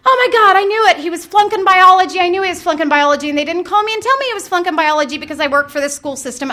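high, loud, racing panic, blurting out fast; a mother's mind jumping to the worst; panicked inner voice. Retold or imitated. Mother panicking to herself as her son asks to follow her. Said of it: "this is what happens in my head".

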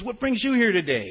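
high, loud imitation of an eager host; excited welcome. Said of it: "Buddy was all excited".

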